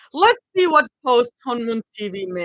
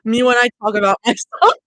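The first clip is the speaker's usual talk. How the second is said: laughing; 2 semitones higher, 7 dB louder than usual. Real, not acted. Joking about herself, laughing.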